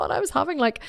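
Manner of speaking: ordinary talk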